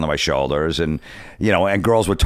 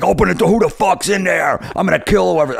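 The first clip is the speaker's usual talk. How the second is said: high, heated mock threats; imitated angry yelling; 12 semitones higher, 3 dB louder than usual. Jealous wrestler threatens from outside the front door, imitated angry yell. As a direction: demand entry like an enraged man, retold.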